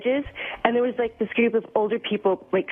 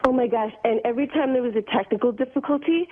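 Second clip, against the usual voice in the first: ordinary talk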